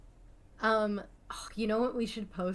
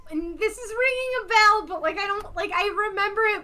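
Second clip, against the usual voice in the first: excited; 11 semitones higher, 9 dB louder than usual. Real, not acted. Sudden memory of pirate episode.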